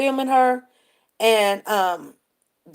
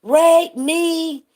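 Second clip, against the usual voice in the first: higher, drawn-out imitated holler; mock yell; 5 semitones higher, much slower than usual. Storyteller imitating intoxicated woman in club, mock yell. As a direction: stretch each word in a pitched-up mock yell. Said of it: "she's yelling".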